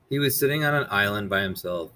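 ordinary delivery

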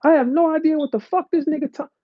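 high put-on voice of disbelief; high incredulous mock voice. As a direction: squeak out a baffled what-the-fuck. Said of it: "I said".

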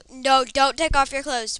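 loud, hasty retraction; mock alarm. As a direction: blurt it loudly in joking protest. Hastily retracts a silly instruction.

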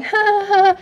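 a high, airy fake laugh; mock carefree laugh. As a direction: imitate the mom laughing it off. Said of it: "mom's like".

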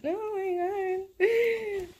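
drawn-out wailing oh my god; slow, crying groan at the screen; anguished cry. Real, not acted. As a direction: cry it out slowly in dismay. Dismay at a game moment.

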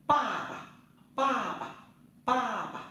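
pa pa said high with a rising second syllable; Chinese tone drill. Shown as a demonstration. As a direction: sing out pa pa high and clear for the class. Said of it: "chúng ta tập nhá".

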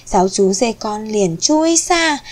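ordinary delivery

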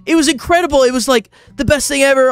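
high, gushing nervous babble. Retold or imitated. Starstruck fan praising the show to its creator, acting out flustered nerves. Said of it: "I got so nervous".

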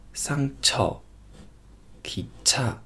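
'ki cha' whispered very slowly; soft slow demo. Shown as a demonstration. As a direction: breathe 'ki cha' out, drawn out and hushed. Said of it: "这样读".

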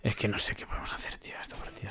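barely audible whisper to self; hushed, voiceless muttering; helpless whisper. Real, not acted. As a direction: whisper it, at a loss. At a loss, talking to himself.

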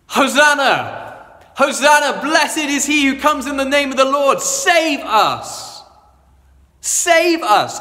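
high, loud ringing cry of a crowd; crowd shouting hosanna. Acted. Preacher voicing the Jerusalem crowd hailing their king, loud shout.

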